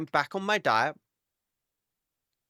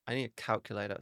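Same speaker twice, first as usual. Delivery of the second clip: ordinary delivery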